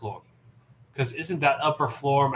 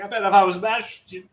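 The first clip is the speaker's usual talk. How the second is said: Tamatoa voice; 6 semitones higher, 5 dB louder than usual. Retold or imitated. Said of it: "He's like".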